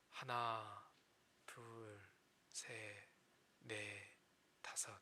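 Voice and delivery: counting to ten softly, sinking to a whisper; quiet slow counting. Shown as a demonstration. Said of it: "열을 세라고 합니다". (점점 작아지며 속삭이듯 천천히 숫자 세기)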